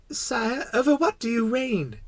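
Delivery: put-on high-pitched small boy voice; curious little prince. Little prince asking what the king rules, curious. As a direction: ask innocently, high-voiced.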